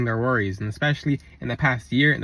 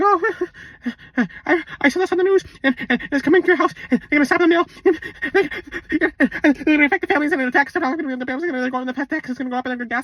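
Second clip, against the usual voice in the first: high, fast, frantic babble; panicky worrier; 9 semitones higher, faster than usual. Retold or imitated. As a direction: rattle off the doom like a panicked gossip.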